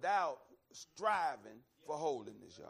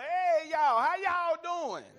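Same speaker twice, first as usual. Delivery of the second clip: loud, high, friendly Southern hollering; calling out a big country hello; 9 semitones higher, 8 dB louder, slower than usual. Retold or imitated. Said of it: "old country bumpkin from Alabama".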